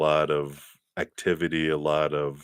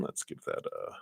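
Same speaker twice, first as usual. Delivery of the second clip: usual speaking voice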